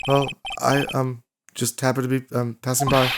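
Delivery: halting, soft-spoken timid man; very quiet ventriloquist. Acted. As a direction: hesitate your way through, unused to your own voice. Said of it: "he'd just be very quiet".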